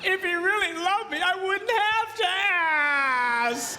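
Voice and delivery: high, drawn-out wifely lament; hammed-up aggrieved whine; mock wailing. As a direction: wail it out, stretching the last word. Mimicking an aggrieved wife's wail.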